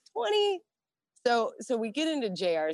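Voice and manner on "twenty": high squeaky disbelief; eighteen-year-old hearing the drinking age is twenty; mock outrage. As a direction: yelp at the news.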